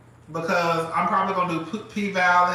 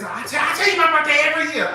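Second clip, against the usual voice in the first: raised, louder retold complaint; exasperated friend; 9 semitones higher, 6 dB louder, faster than usual. Friend scolding her for forgetting the birthday, exasperated. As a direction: imitate her fed-up, high-pitched protest.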